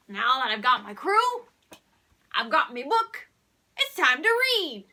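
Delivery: ordinary delivery, nothing marked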